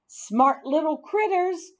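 usual speaking voice

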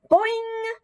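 very high twanging boing like a snapped string; springy cartoon twang; twangy boing. A narrator's reading. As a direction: make it sound like a broken guitar string. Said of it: "like a broken guitar string".

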